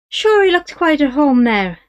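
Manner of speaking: a wheedling Irish trader; Irish horse dealer. Acted. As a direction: sell the horse with easy Irish charm. Dealer pleased with the sale.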